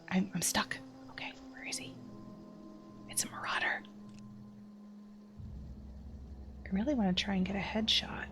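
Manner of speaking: very soft stealth whisper; hushed, voiceless self-talk. Real, not acted. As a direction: whisper to yourself while lining up a shot. Sneaking and aiming in game.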